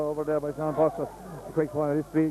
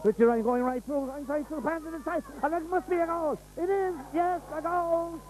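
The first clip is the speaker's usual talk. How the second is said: rising, high-pitched commentary; excited goal call; 12 semitones higher than usual. Real, not acted. Goal build-up in live commentary.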